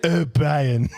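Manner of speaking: posh cartoon baby calling out; a posh, put-on English voice; posh accent. Cartoon baby addressing Brian, posh accent. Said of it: "said in posh accent".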